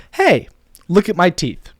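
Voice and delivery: high, slow attention-grabbing read; expressive script hook; call to action. Shown as a demonstration. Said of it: "it's a call to action".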